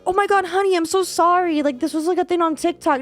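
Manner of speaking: put-on high, sugary apologetic boyfriend; mock sweet apology. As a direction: play the sorry sweetheart. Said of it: "been like".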